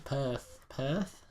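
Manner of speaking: put-on quiet go at an Australian accent; attempted Australian accent. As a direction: test out "Perth" in an Australian accent. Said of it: "I can probably do Australian".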